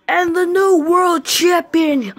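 slow loud champion call; announcer. Acted. Ring announcer declaring Puppy Dog champion, slow loud call. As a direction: crown the winner like a ring announcer.

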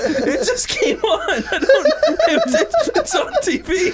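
very high squeal through laughter; laughing panicked excuse. Retold or imitated. Kid excusing the TV to angry dad, laughing squeal. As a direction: act out a flustered kid, cracking up.

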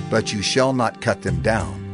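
ordinary talk

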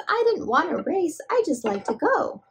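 high little voice for a toy bunny; bunny rabbit voice. Pretend. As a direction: pipe up as the bunny. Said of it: "Bunny Rabbit says".

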